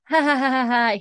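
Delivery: high, forced "ha ha ha"; imitated laugh. Claire humours the man blocking her, fake high laugh. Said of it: "they both, like, laugh. She's like".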